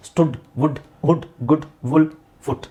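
ಸ್ಟುಡ್, ವುಡ್, ಹುಡ್ each with a quick u; short u, not dragged. Shown as a demonstration. Teacher reading the short-sound word list, short u. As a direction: keep every vowel brief from ಸ್ಟುಡ್ to ಫುಟ್. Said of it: "ನಾವು ಎಳೆಯೋದಿಲ್ಲ".

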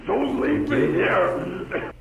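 loud, high and drawn out; slow howling beg; wailed plea. Acted. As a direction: stretch it into a long desperate cry. Echoes the plea to stay.

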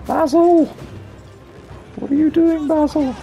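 high-pitched cry of protest; exclaiming at Basil. Real, not acted. Exclaims at the monster mid-fight.

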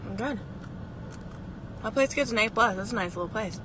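talking while biting macaron; chewing between words; mouth full. Real, not acted. Seen 0: Holds macaron, bites it at lips from +0.8 while talking.

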